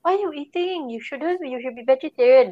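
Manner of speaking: high scolding auntie voice; singlish. Retold or imitated. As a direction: nag like a fussy auntie. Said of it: "My auntie will come and say".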